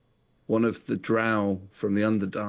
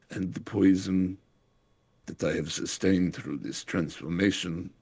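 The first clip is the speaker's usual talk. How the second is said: put-on fading, much softer dying words in a German accent; dying farewell; 4 semitones lower, 5 dB quieter than usual. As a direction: let the breath run out as he dies. Dying leader's last speech.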